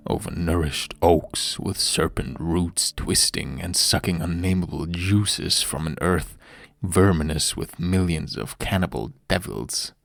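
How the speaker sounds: ordinary delivery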